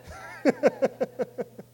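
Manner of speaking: high breathy laugh. Real, not acted. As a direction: laugh at your own joke. Laughs at own joke.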